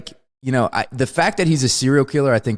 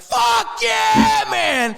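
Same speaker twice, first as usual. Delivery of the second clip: excited cheer; much slower than usual. Retold or imitated. Fan cheering the killing in the film. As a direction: cheer like the villain got what he deserved. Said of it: "I was like".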